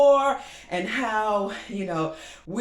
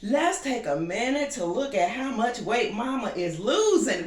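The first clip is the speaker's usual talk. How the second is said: normal talking voice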